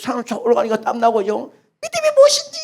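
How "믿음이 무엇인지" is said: much higher, exasperated cry; young man fed up, pitched way up; angry youth. Acted. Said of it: "청년은 화나지만".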